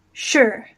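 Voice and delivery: ordinary talk, nothing marked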